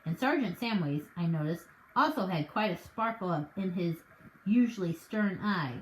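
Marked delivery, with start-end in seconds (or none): none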